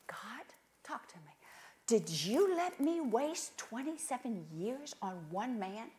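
whispered anguished complaint; quiet bitter prayer. Retold or imitated. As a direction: hush it like a private lament.

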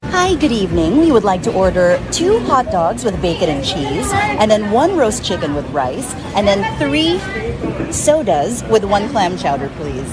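loud ad announcer delivery. Shown as a demonstration. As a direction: boom it out like a store PA. Loud announcer-style order.

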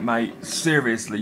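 usual speaking voice

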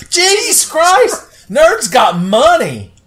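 high, slow, loud joyous yell; excited shout. Real, not acted. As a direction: scream with stunned delight. Shocked at receiving money.

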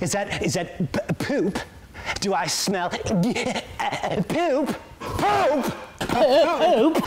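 sniff, then shout poop wildly; Nicolas Cage impression. Shown as a demonstration. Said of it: "Nicolas Cage".

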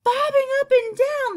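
very high, loud incredulous cry; cried out. A narrator's reading. Creatures echoing the ladybug's notion, crying out. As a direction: exclaim it high and loud in disbelief. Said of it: "they cried".

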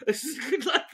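ordinary talk, nothing marked

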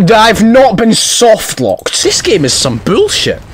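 high, exasperated gamer rage; high-pitched frustrated yelling; angry outburst. Real, not acted. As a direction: complain in high, outraged disbelief. Raging at a broken game.

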